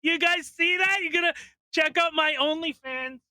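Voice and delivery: very high, loud squeal; hammed-up shrieking excitement for the followers; mock over-excited influencer. Poster plugging OnlyFans to the guys, mock hysterical excitement.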